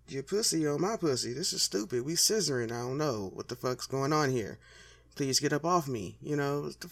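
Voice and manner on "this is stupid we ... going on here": ordinary talk, nothing marked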